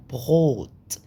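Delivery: بغوت said slowly, the g sound from the throat; German word demo. Shown as a demonstration. Teacher to students, showing the throat sound: slow throaty demo. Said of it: "بتنطق من الحلق كده".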